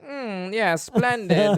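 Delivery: plummy mock-British approval, drawn out; slow, hammed-up British "splendid"; British officers. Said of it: "'cause they're all British".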